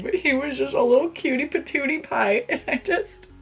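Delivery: high, giddy gushing over a crush. Real, not acted. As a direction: swoon over him like a fangirl.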